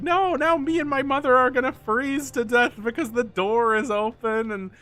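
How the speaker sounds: high-pitched mock bawling; imitated crying. Friend crying about freezing to death, mock bawling. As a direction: imitate a kid wailing, dissolving into sobs. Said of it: "He was crying about".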